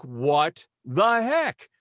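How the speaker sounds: exasperated disbelief. Retold or imitated. Exasperated after being hung up on.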